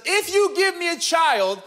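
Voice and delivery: high, drawn-out plea to God; pleading. Retold or imitated. Hannah begging God for a child, pleading. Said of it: "pleads with God".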